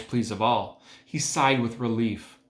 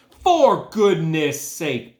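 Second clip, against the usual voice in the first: louder, very slow bellow; 5 semitones higher, 7 dB louder, much slower than usual. A narrator's reading. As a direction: bellow it out soaking wet. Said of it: "bellowed Mister Zuckerman".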